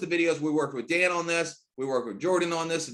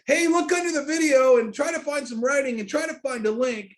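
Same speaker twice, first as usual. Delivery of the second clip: exasperated instruction; 8 semitones higher, 5 dB louder than usual. Retold or imitated. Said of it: "They don't have to say".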